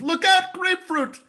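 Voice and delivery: high, sudden yelled product name; called-out warning. Acted. Comic pitching Look Out Grapefruit to audience, mock warning shout. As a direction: cry it out like an alarm.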